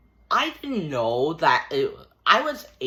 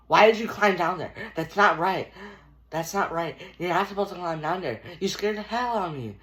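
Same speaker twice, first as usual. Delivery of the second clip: mock scolding neighbor; 5 semitones higher, faster than usual.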